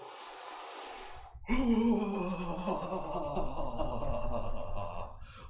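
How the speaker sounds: big shaky exhale ending in a laugh; long release sigh. Shown as a demonstration. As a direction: let the breath go with a laughing sigh.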